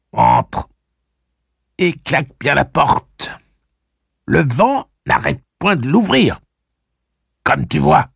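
put-on very high, loud cackling old woman; strident crow voice. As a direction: bark orders like a screeching crone. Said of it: "une voix stridente de corneille".

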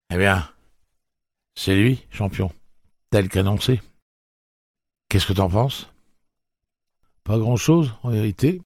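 casual banter, voice lifted a little; voices of Eddie and Jake. Acted. Eddie greets Jake at the monorail: lightly voiced banter.

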